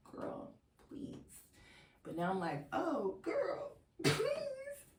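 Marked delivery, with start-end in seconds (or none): 0.1-1.4 quiet, toneless brush-off; dismissive. Retold or imitated. Retelling her past dismissal of his warning. 2.7-4.9 very high, squeaky plea; mock pleading. Now regretful, mock pleading contrast.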